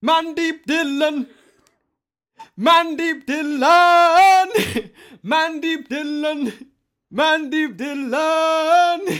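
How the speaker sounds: slow, loud, high drawn-out introduction; grand announcer call. Acted. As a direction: announce the guest like a ring announcer.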